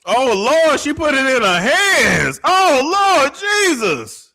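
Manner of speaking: high, loud, stunned "Oh Lord"; gasping Lord Jesus at what she just saw; shocked cry. Real, not acted. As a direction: call on the Lord in disbelief. Stunned by drug use on screen.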